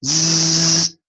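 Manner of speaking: z with the voice buzzing; buzzing voiced z. Shown as a demonstration. Teacher turning the s into a voiced z. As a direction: put voice into the hiss. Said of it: "now put a voice in it".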